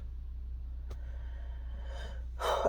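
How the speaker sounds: long, soft exhale; long sigh. Real, not acted. Storyteller after recalling the threat to their hand, sighing. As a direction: let out a long quiet breath. Pause after painful childhood memory.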